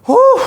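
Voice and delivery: loud high breathy whew; exertion whew. Real, not acted. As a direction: blow out hard after effort. Exhale during workout.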